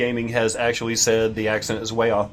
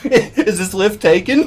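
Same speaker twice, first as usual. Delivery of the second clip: slow, much higher, louder British voice, laughing; mock British stranger; 8 semitones higher, 8 dB louder, slower than usual. Host voicing the stranger in the lift, mock British. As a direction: play the polite Londoner at the lift door. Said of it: "asks if they could join me".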